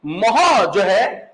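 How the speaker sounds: मोह stretched very slowly with a breathy ह; teacher drawing out मोह for the class; drawn-out demo. Shown as a demonstration. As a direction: sound out मोह slowly so the ह is heard. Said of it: "नया महाप्राण".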